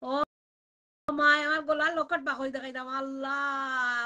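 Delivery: ordinary talk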